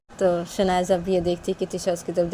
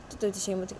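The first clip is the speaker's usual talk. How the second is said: plain reading voice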